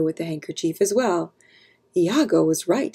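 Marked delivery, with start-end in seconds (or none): none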